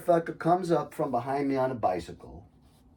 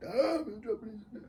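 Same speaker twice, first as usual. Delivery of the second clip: smug self-praise; 11 semitones higher, 5 dB quieter than usual. Retold or imitated. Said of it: "I just like".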